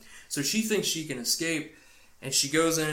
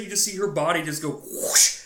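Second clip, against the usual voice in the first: usual speaking voice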